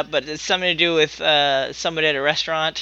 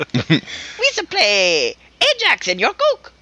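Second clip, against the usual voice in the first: drawn-out, then high goofy Jar Jar Binks impression; 3 semitones higher, much slower than usual. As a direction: stretch it, then squeak it like the goofy alien. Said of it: "You all right, Jar Jar?".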